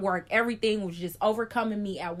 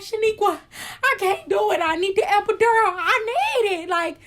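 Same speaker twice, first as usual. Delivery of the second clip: high, louder pleading; desperate plea; 9 semitones higher, 5 dB louder than usual. Retold or imitated. Patient begs her friend for the epidural, high and desperate. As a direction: beg for the epidural.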